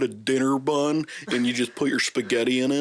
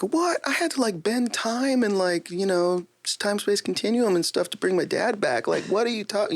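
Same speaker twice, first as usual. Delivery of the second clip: high indignant squeaky voice; outraged wizard who bent time; high exasperated wizard; incredulous wizard; 10 semitones higher than usual. Retold or imitated. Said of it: "they're like".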